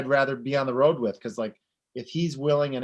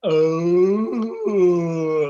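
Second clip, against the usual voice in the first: whale grunt; 2 semitones higher, 8 dB louder than usual. Pretend. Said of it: "It's a nice earthy, moany grunt".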